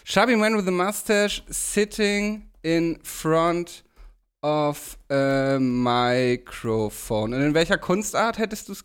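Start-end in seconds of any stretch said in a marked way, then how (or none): 0.0-7.3 slow dictation. Real, not acted. Typing the prompt aloud.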